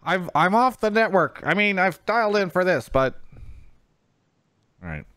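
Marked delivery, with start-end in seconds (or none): 0.0-3.2 high squeaky bunker caller; paranoid prepper voice. Pretend. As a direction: play the off-grid paranoid caller. Playing the doomsday caller.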